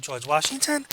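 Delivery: "George Washington" ending on a sharp upward lift; rising inflection. Shown as a demonstration. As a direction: turn "Washington" into a question with rising pitch. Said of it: "How it goes up".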